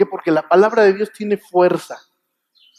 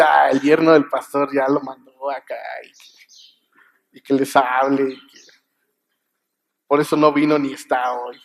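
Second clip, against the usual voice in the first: mock complaining congregant; 5 semitones lower than usual. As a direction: play the skeptic griping about the pastor's son-in-law. Imagined objection from a congregant. (feligrés quejoso, lento y más grave)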